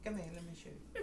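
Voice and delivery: soft, high bad British accent; horrible British accent. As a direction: badly fake a British accent, quietly. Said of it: "That was a horrible accent".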